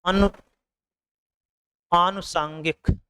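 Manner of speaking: usual speaking voice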